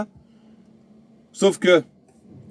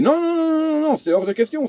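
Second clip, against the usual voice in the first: high-pitched heated refusal; 12 semitones higher than usual. Retold or imitated. Trucker refusing the tow driver, heated high-pitched protest. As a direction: pitch way up, firing off the nos.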